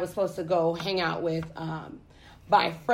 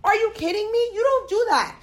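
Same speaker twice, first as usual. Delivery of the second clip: much higher, incredulous outburst; outraged disbelief; 11 semitones higher, 3 dB louder, faster than usual. Retold or imitated. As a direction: hit them with furious disbelief. Reliving outrage at friends.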